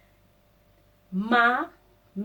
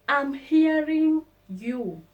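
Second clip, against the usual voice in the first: lightly voiced example, higher and slow; mock example voice; 6 semitones higher, 4 dB louder, slower than usual. Shown as a demonstration. Teacher acts out the sample sentence, higher and slow.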